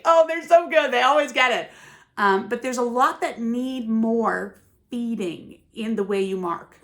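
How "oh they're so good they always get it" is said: delighted teacher. Retold or imitated. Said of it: "You're like".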